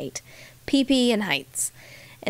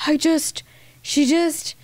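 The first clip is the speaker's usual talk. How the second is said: mock dog voice; 6 semitones higher than usual. Voicing the dog in story.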